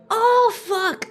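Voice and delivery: startled yelp. Real, not acted. Sudden interruption.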